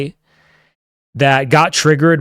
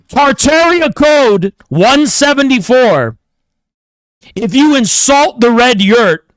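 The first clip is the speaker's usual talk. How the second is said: mock decree; 4 semitones higher, 4 dB louder than usual. Streamer mocking a viewer, pompous hammed-up decree. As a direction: read it out like a tyrant's edict.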